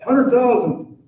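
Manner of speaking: loud, slow, drawn-out auctioneer call. Real, not acted. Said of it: "I sound like an auctioneer now".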